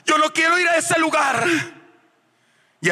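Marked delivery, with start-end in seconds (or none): 0.0-1.5 high shouted plea; cry of terror. Retold or imitated. Falling man crying to the Lord, retold desperate shout. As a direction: beg desperately not to be taken there.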